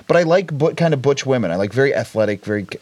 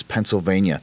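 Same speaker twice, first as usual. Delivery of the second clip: put-on Eastern European accent; 8 semitones lower, 4 dB quieter than usual. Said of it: "in the thickest Eastern European accent".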